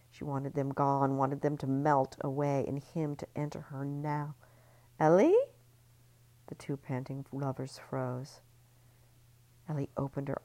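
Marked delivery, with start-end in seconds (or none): 5.0-5.4 loud high call. A narrator's reading. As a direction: call her name into the room. Calling out in the dark.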